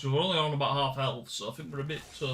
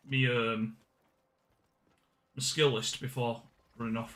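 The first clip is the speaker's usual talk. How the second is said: ordinary delivery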